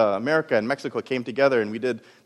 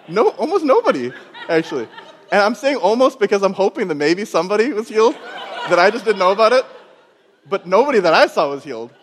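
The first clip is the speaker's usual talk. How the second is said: louder and higher, laughing through it; laughing admission; 5 semitones higher, 6 dB louder than usual. Real, not acted. The preacher laughs at his failed healing prayers. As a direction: laugh at yourself while confessing.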